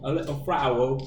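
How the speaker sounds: copying a mock-English accent; lightly mimicked English accent; imitated English accent. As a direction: echo the friend's put-on English accent. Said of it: "Is that your English accent?".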